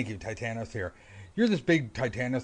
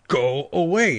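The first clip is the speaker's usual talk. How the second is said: "Go away." said louder and sharper, a stern dismissal; firm go away; 6 semitones higher, 10 dB louder, slower than usual. Retold or imitated. Neytiri ordering Jake off the branch, louder and stern. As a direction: bark it like a final warning.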